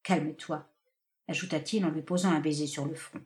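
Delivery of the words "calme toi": calm soothing. Acted. Benjamin soothing her, kisses forehead.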